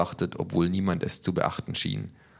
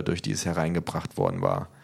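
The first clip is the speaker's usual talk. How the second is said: ordinary delivery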